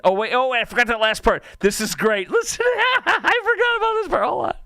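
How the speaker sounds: high, loud burst breaking into laughter; delighted excitement. Real, not acted. Radio host remembering the last part of the clip, giddily excited. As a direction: blurt it out, giddy and laughing.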